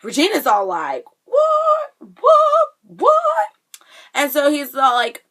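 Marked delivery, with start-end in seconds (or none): 1.2-3.5 very high, loud squeaked repeats; hammed-up high-pitched shock; stunned disbelief. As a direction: play stunned with a shrill, exaggerated pitch leap. Acting out Regina's stunned reaction.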